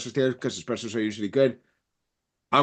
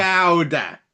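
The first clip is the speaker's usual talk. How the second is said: "Chowder" in a broad New England accent, louder and higher; hammed-up New England "Chowder", chowdah; 4 semitones higher, 6 dB louder than usual. Shown as a demonstration. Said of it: "put that New England accent on there".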